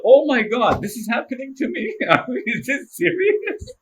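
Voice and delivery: high, loud, laughing; overjoyed, can't believe it's happening; elated disbelief. Retold or imitated. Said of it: "I felt elated".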